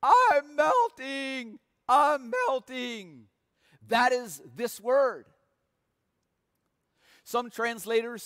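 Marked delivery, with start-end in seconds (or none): 0.0-3.3 high, slow, drawn-out wail of "I'm melting"; a lightly hammed Wicked Witch shriek; Wicked Witch screaming. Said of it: "and she screams".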